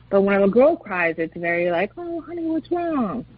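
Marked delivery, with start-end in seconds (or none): 2.0-3.2 high, soft, slow mock-soothing coo; cooing sympathy. Parent to a crying little girl, cooing sympathy. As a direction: imitate a doting parent fussing gently.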